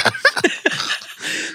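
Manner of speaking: airy high-pitched laugh; high breathy laugh. Real, not acted. Laughing at the ball player's offer, breathy high laugh.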